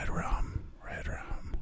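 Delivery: creepy Shining whisper; spooky redrum whisper. Retold or imitated. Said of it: "That's not how he says it".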